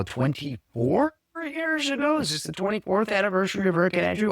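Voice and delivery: unsure question. Real, not acted. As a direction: stretch it out as a doubtful guess. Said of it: "I'm sorry".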